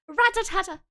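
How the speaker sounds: high playful rat-tat-tat; imitated gunfire. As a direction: rattle it like a machine gun.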